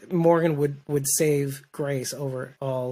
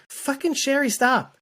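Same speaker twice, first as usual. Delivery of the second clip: exasperated mock scold; 10 semitones higher, slower than usual.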